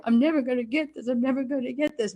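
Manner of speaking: fast, hammed-up despairing moan; a joking, fast lament over hard lines; mock despair. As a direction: play a new cast member close to giving up.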